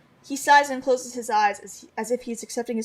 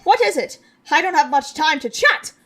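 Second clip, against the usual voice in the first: impatient shout; 4 semitones higher, 8 dB louder than usual. Acted. Squidly impatient, loud.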